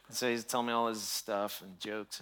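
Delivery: usual speaking voice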